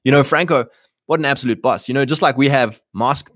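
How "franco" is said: normal talking voice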